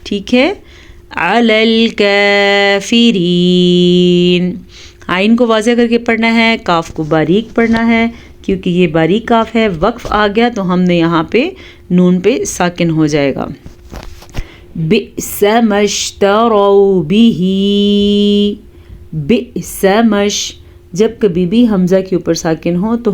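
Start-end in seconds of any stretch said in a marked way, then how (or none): 1.2-4.6 slow, drawn-out tajweed chant; Quran recitation. Shown as a demonstration. Teacher reciting for students. 14.8-20.5 slow, drawn-out tajweed chant; Quran recitation. Shown as a demonstration. Teacher reciting for students.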